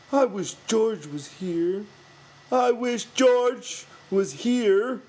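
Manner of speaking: put-on high, slow, childlike whine; whining Lenny. A narrator's reading. Lenny whining for George to come back. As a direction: drag it out like a frightened big child. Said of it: "Lenny whined".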